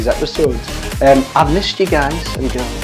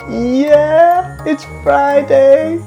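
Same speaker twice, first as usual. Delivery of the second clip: excited cheer; 10 semitones higher, much slower than usual. Acted. Hug time, cheering.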